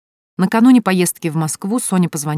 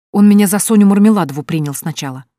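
blurted out; 4 semitones higher, 7 dB louder than usual. A narrator's reading. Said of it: "выпалила Соня".

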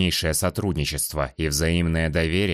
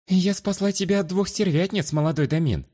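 meek; 11 semitones higher than usual. Acted. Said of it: "смиренно ответила женщина".

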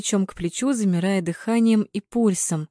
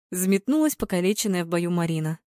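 usual speaking voice

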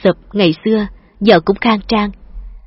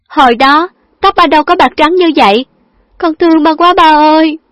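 tearful; 8 semitones higher, 5 dB louder than usual. A narrator's reading. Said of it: "rồi khóc ngon lành".

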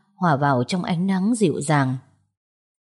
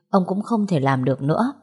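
ordinary delivery

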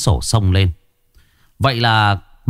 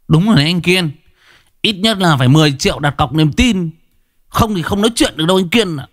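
higher, cocky thug voice; smug thug, higher voice; thug Phấn; 8 semitones higher, faster than usual. Acted. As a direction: push the debtor like a smug punk. Phấn backs the demand.

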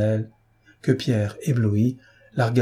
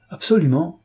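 emphatic, fiery answer, pitched up and loud; with fire; 8 semitones higher, 4 dB louder than usual. A narrator's reading. Pierre answering his friend hotly, with fire. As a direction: fling the word out with passion. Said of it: "répondit Pierre avec feu".

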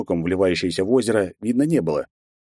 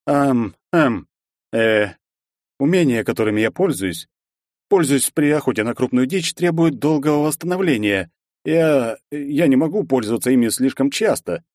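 higher, hesitant flustered voice; flustered prince; 5 semitones higher than usual. Acted. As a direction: stammer like a caught-out prince. Prince stammering, confused.

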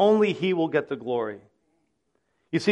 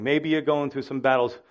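ordinary delivery

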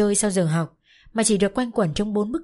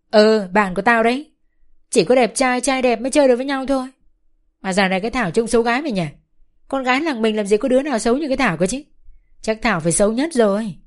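higher, louder, fast mock boasting; gleeful teasing; 4 semitones higher, 5 dB louder than usual. Bách teasing Thảo as ugly to her face, gleeful and loud. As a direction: show off and poke fun at Thảo. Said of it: "vừa nói vừa cười khoái chí".

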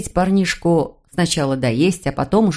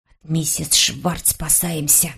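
put-on slow, hushed, secretive boy; conspiratorial whisper; 3 semitones lower, 5 dB quieter, slower than usual. A narrator's reading. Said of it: "заговорщическим шёпотом".

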